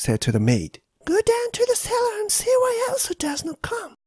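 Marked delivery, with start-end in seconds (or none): 1.0-4.0 master's voice. Acted. Master sends maid to cellar.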